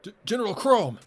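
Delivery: high, loud stammered call; alarmed cry. Acted. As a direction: cry out in shock to a fallen commander. Character cries out for crushed general.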